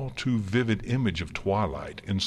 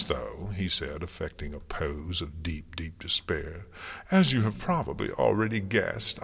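mocking trickster Discord; 2 semitones higher than usual. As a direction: play the taunting showman, savouring it. Plays Discord toying with queen.

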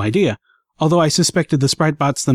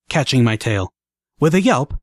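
plain reading voice

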